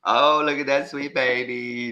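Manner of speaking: put-on high sing-song baby talk; cooing at a baby. Grandma on video call cooing at the baby, high. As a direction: coo sweetly at the grandbaby. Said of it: "I'm on the other end going".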